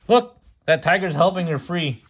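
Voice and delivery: gasping, high and loud, thrilled by the picture; excited gasp. Real, not acted. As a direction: point at the page in sudden delight. Child reacts excitedly to picture.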